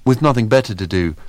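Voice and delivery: ordinary delivery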